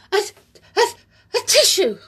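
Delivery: halting, high build-up to a sneeze. Acted. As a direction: hold the sneeze, then reach for a tissue. Amelia about to sneeze.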